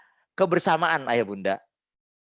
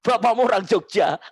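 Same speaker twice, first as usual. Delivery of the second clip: mock messy scolding; 8 semitones higher, 5 dB louder than usual. Shown as a demonstration. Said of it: "Ini cara marah yang kacau".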